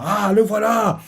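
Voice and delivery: high mock shout of a pirate; pirate calling out. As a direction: cry out spotting him. Pirates crient en repérant Kobe. (cri de pirate, aigu et moqueur)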